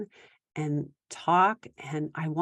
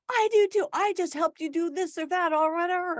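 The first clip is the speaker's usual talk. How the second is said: high defensive retort; imitating a parent defending herself; mock defensive parent; 11 semitones higher than usual. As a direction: snap back defensively. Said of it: "we start saying like".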